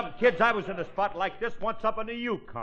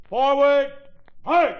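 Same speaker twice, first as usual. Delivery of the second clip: loud, high drill-sergeant command; shouted marching command; marching order; 7 semitones higher, 8 dB louder, slower than usual. Acted. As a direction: call the troop into step. Leader gives marching order.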